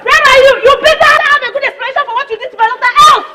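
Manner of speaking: high, loud furious yelling; furious shout. Acted. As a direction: storm in screaming for her. Angry mother storming the house.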